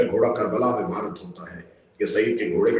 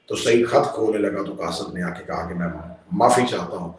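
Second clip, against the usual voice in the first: normal talking voice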